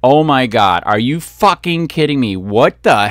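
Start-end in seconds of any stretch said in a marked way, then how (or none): none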